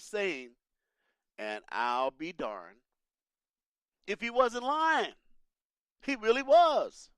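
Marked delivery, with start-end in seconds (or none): 4.1-7.1 higher, slow emphatic exclamation. Real, not acted. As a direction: land it slowly with rising conviction. Emphatic realization in hindsight.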